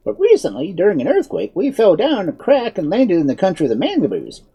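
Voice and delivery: high-pitched, animated wizard; explaining wizard. A narrator's reading. Wizard explaining their fall to the woman, animated.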